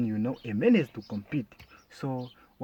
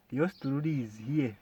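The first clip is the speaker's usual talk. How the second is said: usual speaking voice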